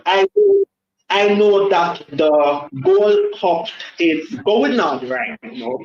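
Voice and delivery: usual speaking voice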